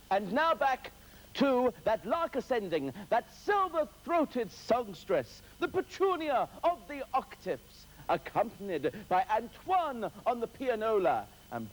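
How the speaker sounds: lofty, stretched-out compere build-up, high; grand showbiz intro. Acted. Host hamming up the intro of a singer for viewers, grand compere voice. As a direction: announce her like the star of the night.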